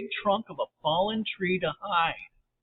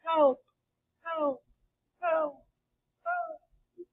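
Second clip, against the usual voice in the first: high yell fading away like an echo; 11 semitones higher, much slower than usual. A narrator's reading. Sally's yell echoing through the cave, fading. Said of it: "heard her voice echo".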